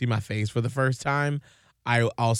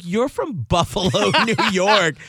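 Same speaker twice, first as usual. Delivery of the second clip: higher, laughing retort; amused disbelief; 4 semitones higher, 4 dB louder than usual. Retold or imitated. Retelling a rebuke to boyfriend's Southern accent, amused disbelief. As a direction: call out the fake accent through a laugh. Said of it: "and I'm like".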